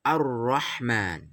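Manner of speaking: Ar-Rahman slowly, the lam merged into a doubled rr; slow recitation of Ar-Rahman with doubled r; correct tajwid. Shown as a demonstration. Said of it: "tapi harus". (Ar-Rahman dibaca pelan, lam lebur ke ra)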